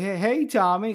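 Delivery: a hint of a kid calling out; lightly voiced Jeremy. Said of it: "he's like".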